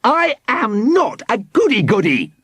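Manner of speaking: high-pitched indignant outburst; furious roar. A narrator's reading. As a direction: deny the insult in a fury. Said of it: "roared Formby, turning red with fury".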